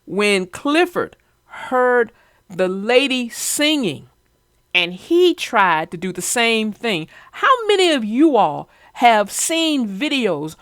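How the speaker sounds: usual speaking voice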